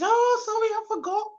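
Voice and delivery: high, breezy mock-apology as her mum; imitating mum. Daughter imitates her mum forgetting the live, high apologetic voice. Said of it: "And she's".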